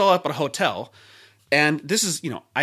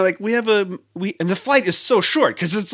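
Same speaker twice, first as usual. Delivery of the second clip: usual speaking voice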